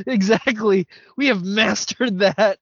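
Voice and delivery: loud, high and amused; slow, emphatic mock pride; mock-triumphant. Real, not acted. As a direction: boast proudly through the laughs. Laughing along in mock boast.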